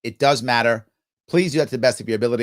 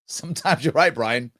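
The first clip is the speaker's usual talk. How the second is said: ordinary delivery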